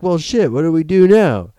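loud, high cry of dismay; mock exasperation. Stranded astronauts asking each other what next, in loud mock exasperation. Voicing the astronauts' alarmed reaction.

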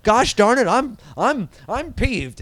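a hint of a squeaky-clean guy getting peeved; Richie Cunningham pissed off. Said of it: "Richie Cunningham pissed off, Happy Days style".

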